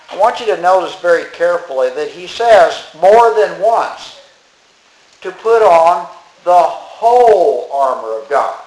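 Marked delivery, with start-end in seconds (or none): 5.2-8.6 slow, with "whole" leaned on hard; stress on whole. Shown as a demonstration. Pastor stressing to the church the word "whole", slowly. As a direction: press down on "whole" and draw the line out. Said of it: "He is really emphasizing".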